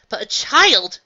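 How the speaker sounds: high, loud disbelieving cry; shocked cry. A narrator's reading. Astor cries out in disbelief at the plan.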